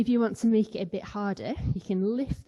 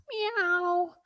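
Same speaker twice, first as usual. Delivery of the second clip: a much higher, light kitten meow; happy cat meow; 9 semitones higher than usual. Pretend. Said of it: "Happy cat".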